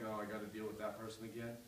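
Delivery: mock groggy grumble, soft and fast; sleepy grumbling Jesus. Preacher mocking a sleepy, put-upon Jesus, soft fast grumble. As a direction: grumble like you just rolled out of bed. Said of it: "he's kind of waking up like".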